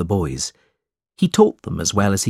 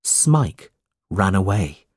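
usual speaking voice

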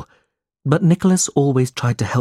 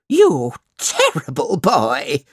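higher, slow scolding woman's voice; shrill scolding; 6 semitones higher, 4 dB louder, slower than usual. A narrator's reading. Missus Squeers scolds Smike, higher and slow. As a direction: scold him as a spiteful wife. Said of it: "shouted Missus Squeers".

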